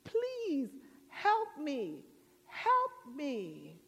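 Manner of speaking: high, hurried yeses into drawn-out begging; hammed-up desperate man; man pleading. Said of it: "And the man says".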